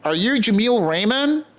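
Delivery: staff voice. Retold or imitated. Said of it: "and they go".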